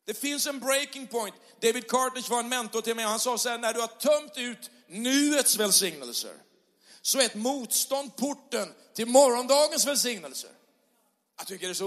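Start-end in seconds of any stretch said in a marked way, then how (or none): 0.0-10.5 rousing pulpit climax; full-force preaching. Acted. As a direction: preach it to the whole room with fire. Preaching, quoting his mentor.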